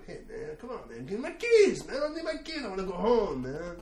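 high, loud, desperate plea for the keys; pleading for keys. Retold or imitated. Surfer begging the lifeguard for his keys, loud and high. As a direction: beg the wrong lifeguard to hand over the keys.

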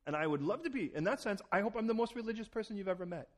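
ordinary delivery

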